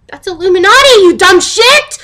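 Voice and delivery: high, loud exasperated shout; worked up. Acted. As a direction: yell the insult, all worked up. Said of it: "I'm getting all worked up".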